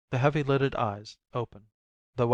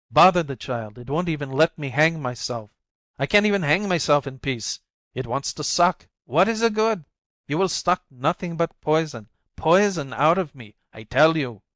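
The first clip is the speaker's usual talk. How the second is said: loud, raised furious outburst; 4 semitones higher, 6 dB louder than usual. Acted. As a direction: snap at the child. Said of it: "paroxysm of anger".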